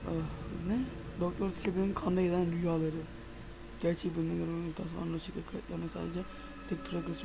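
hushed reading of notes; quiet reading aloud. Acted. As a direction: read the in-game notes softly to yourself. Reads in-game documents aloud.

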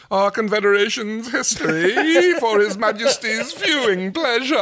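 alien delegate voice. Acted. Alien delegate presents gift.

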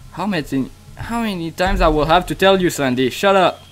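exasperated scolding. Real, not acted. Telling Sandy for the umpteenth time, exasperated scolding.

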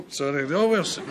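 ordinary talk, nothing marked

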